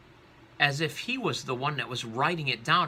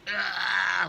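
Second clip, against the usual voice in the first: high, loud drawn-out wail; shaken man's cry; 9 semitones higher, 5 dB louder than usual. Acted. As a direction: wail as if being violently shaken. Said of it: "shakes the man violently".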